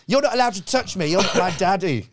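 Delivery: high-pitched imitation of a bratty child; protesting little boy. Small boy telling off the comic, high mock-child protest. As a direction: protest like an indignant small kid.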